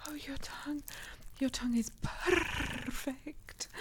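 breathless whisper. Acted. Catgirl in the window, whispering in awe to her partner. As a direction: whisper it as if overcome.

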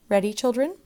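teacher calling class. A narrator's reading. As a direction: call brightly across a classroom.

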